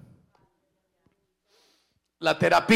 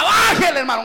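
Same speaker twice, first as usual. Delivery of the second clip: higher, louder mock "Bájele" shouted back at him; mock complaint; 7 semitones higher, 5 dB louder, faster than usual. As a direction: throw "Bájele, hermano" out as a loud demand. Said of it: "usted me diga".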